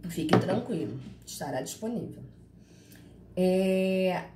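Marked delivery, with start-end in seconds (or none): none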